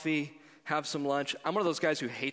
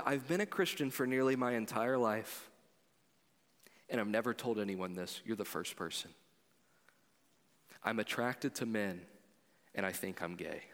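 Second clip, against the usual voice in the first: dropping lower and softer toward a near whisper; confession; 4 semitones lower, 4 dB quieter than usual. Retold or imitated. Friend confessing to his pastor over lunch, hushed and low. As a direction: confess it quietly, afraid to say it. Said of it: "He then went on to say".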